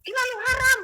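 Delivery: loud, high-pitched indignant outburst; angry. Retold or imitated. Retorting to the voice on her right, angry. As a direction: shout it back in re-enacted outrage. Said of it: "marah sebelum saya ambil Alkitab".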